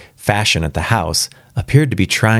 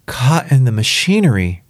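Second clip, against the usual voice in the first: higher-pitched, mildly dazed echo; 6 semitones higher than usual. A narrator's reading. Father repeating the visitor's news, mildly dazed. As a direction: repeat it as if not taking it in. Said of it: "in a dazed fashion".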